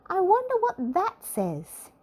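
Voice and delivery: high, wondering character voice; a curious little man puzzles at the writing; Mister Nosey, curious. A narrator's reading. A curious little man asks about the writing, high and wondering. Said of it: "he asked".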